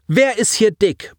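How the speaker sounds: high, offended comeback; indignant protest. A narrator's reading. Boy called fat, protesting indignantly. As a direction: object as if insulted. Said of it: "protestierte Colin".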